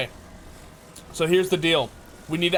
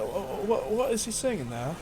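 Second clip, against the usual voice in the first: light mock impression, softer and broken by laughs; puzzled listener, chuckling through the imitation; 3 semitones higher, 5 dB quieter than usual. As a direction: stammer it out as a bemused critic. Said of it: "He'd probably be like".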